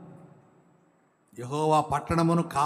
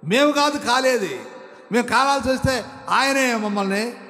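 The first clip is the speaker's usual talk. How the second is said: higher and louder declaration; full-force preaching; 9 semitones higher, 6 dB louder than usual. Acted. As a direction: proclaim it to the whole church. Preaching God's might. (ఉద్రేకంతో ప్రకటన)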